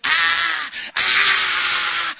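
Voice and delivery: long, high, loud yell; venting scream. Acted. Acting a client told to shout, venting scream. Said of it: "هر چی هم خواستی داد بزن".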